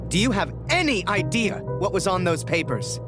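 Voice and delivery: high, indignant dressing-down; roared rebuke. A narrator's reading. Major berating the soldier about lost papers, roaring angrily. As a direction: voice the furious Major, pitched well up. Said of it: "he roared, inches from my face".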